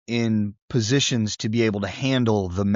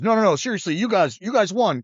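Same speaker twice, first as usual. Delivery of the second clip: higher urgent insistence; urgent insisting; 7 semitones higher than usual. Retold or imitated. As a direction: wave them up in a hurry, pitched up.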